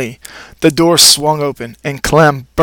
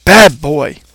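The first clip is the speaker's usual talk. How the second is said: firm scolding. Acted. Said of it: "she shouted in a very firm voice".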